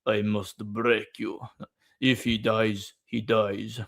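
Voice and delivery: put-on slow, heavy Russian accent; slow Russian boxer menace; Ivan Drago voice. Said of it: "He's like".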